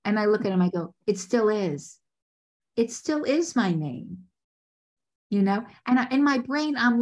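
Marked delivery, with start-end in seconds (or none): 1.1-4.3 deliberate retort, slowing down; retold retort, stretched out at the end; pointed comeback. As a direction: answer back coolly and pointedly. Pointed comeback to condescending man.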